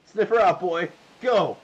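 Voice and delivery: loud, high barked command to sniff; commanding shout. Acted. Bandit shouting at the boy to track like a dog. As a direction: order him out loud like a dog handler.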